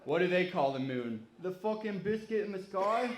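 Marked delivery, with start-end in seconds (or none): 1.4-3.1 a higher-pitched mock British accent, rising on sky. Comedian replaying his joke in a mock British accent. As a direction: lampoon a Brit asking about the moon in a British accent. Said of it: "That's my British accent".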